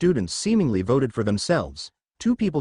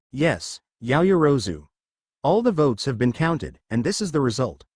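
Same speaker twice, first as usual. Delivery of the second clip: ordinary delivery, nothing marked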